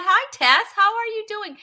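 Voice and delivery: high-pitched, effusive hello; excited greeting. Real, not acted. Host greets Tess as she joins, excited. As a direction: sing out a hello to a regular. Greeting an arriving audience member.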